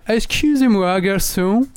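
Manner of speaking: put-on Allemand imitant un accent anglais; accent anglais un peu forcé, plus aigu. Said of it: "il prend un accent anglais". (accent anglais surjoué)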